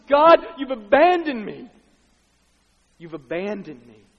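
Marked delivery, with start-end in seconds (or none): 0.1-1.7 loud anguished cry. Acted. Jesus crying to God from the cross, loud cry. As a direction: cry out in abandonment at full voice. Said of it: "he cries out with a loud voice".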